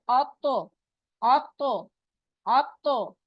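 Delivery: आप तो with a bounced, echoing t; bouncing echo. Shown as a demonstration. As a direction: let the तो snap and bounce back. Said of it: "आवाज टकरा के वापस आती है".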